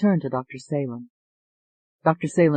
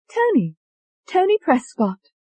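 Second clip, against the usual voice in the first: put-on high, proud English accent; 13 semitones higher, 3 dB louder than usual. Tony introducing herself to the doctor in an English accent. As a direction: announce the name in English accent.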